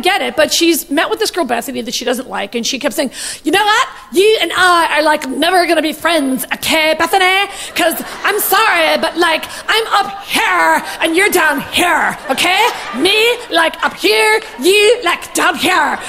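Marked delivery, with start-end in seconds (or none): 3.4-9.4 put-on teenage Valley girl accent; mocking California Valley girl, stretched vowels and lilting phrase ends. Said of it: "fourteen-year-old girl from the Valley in California accent". 9.7-12.2 put-on slow, drawn-out Valley girl accent. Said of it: "fourteen-year-old girl from the Valley in California accent". 12.3-16.0 mock Valley girl accent; condescending California teen, words parcelled out with stretched vowels. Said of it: "fourteen-year-old girl from the Valley in California accent".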